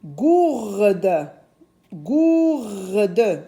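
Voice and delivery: gourde slowly, with a long "ou"; sounded-out word. Shown as a demonstration. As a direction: hold the vowel in "Gourde". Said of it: "J'entends le son ou". (gourde avec le son ou allongé)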